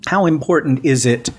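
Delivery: ordinary delivery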